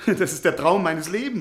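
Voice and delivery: delighted laugh. Acted. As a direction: burst out with joy at the offer. Professor offered a long journey. (lachend vor Freude)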